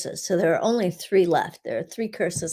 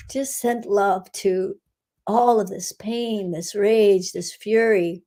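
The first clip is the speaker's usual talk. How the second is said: guided meditation. Acted. Healer guiding listeners through a meditation, calm and steady. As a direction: lead the group gently through the energy work. Leads a curse-clearing meditation.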